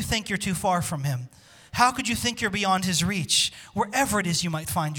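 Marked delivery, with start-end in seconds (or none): none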